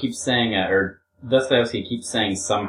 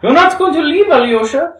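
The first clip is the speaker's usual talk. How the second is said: very high, loud, dismayed cry; shocked plea, pitched way up; sorrowful amazement; 9 dB louder than usual. Acted. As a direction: exclaim it in pained disbelief. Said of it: "she exclaimed in sorrowful amazement".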